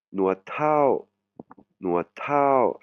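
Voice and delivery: "Nuat Thao" pitched high, with an aspirated T; "Thao" with breath after the T; Thai pronunciation. Shown as a demonstration. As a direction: model "Nuat Thao" for the class. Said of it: "vous voyez, T H".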